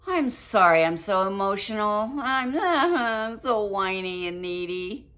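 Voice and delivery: mock a blubbering, over-emotional apology; loud, exaggerated whiny wail; whiny apologizer.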